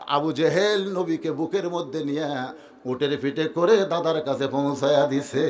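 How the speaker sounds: low, soft melodic narration; subdued storytelling. A narrator's reading. Preacher tells of Abu Jahl carrying the child, low and soft. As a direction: tell it low, stretching the phrase endings.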